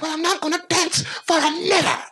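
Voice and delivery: high, slow, shouted mock outrage; furious stripper impression. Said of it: "she's like".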